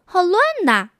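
put-on high, dismayed little girl voice; a child wailing at the mess. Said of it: "她嚷道".

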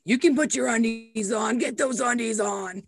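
put-on barking orders at a toddler; bossy morning drill voice; drill sergeant. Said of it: "I'm like a drill sergeant in the morning".

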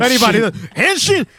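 high excited transformation cry; bright magical girl. Pretend. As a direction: shout Henshin! like an anime hero. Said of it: "I'm calling it a henshin scene".

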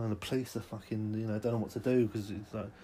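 ordinary talk

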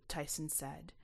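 ordinary delivery, nothing marked

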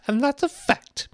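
very high, loud retort; imperious high-pitched character voice; disagreeable Duchess. A narrator's reading. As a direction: squawk it as the final word. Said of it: "did not at all like the tone of this remark".

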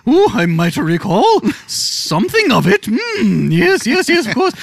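hammed-up high comic voice; mock-pompous character. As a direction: ponder it way up high. Mock character answer in skit.

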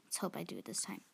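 usual speaking voice

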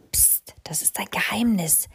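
voiceless secret whisper; hush, share a secret. A narrator's reading. Mama Mu telling the crow her secret, whispering.

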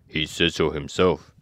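lightly voiced, slow scarecrow; a hint of a slow scarecrow. A narrator's reading. Scarecrow reassuring the mouse, lightly put-on slow voice.

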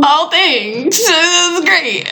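high, slow, fake-grateful thanks; fake gratitude. Daughter opening birthday gifts from mom, fake-polite slow high thanks. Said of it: "I was like".

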